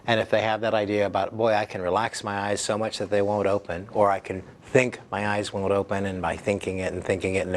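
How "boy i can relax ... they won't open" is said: usual speaking voice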